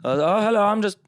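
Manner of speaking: high, loud, put-on vampire voice; hammed-up shy vampire asking to go on; [name]'s vampire voice. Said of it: "he plays a vampire in our shows".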